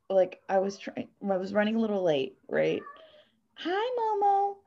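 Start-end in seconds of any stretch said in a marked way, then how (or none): 3.5-4.6 high slow sing-song hello; pet voice. Real, not acted. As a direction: coo at the pet. Greeting the pet.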